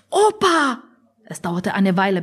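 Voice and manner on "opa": high-pitched calling of a name; urgent call. Acted. Oma wakes sleeping Opa, high urgent call.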